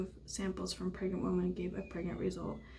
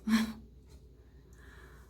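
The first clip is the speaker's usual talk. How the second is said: nervous little laugh. Real, not acted. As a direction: laugh away the nerves.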